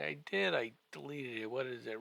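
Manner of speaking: normal talking voice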